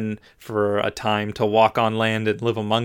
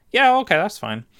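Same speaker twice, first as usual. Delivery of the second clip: naive cookie; 9 semitones higher than usual. Acted. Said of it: "gingerbread man is like".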